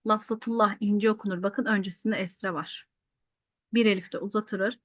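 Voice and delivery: ordinary talk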